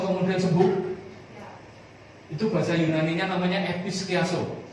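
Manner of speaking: high, loud, driving preacher's voice; fervent preaching. Acted. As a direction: preach it to the congregation with fire. Preaching at full force. (berkhotbah dengan berapi-api)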